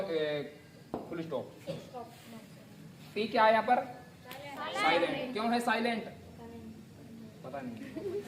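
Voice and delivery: normal talking voice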